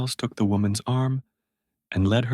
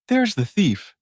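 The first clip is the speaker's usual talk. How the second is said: high, loud accusing cry; the woman accuses Edward in the street; 11 semitones higher, 5 dB louder than usual. Acted. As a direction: point and shout at the boy. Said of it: "she cried".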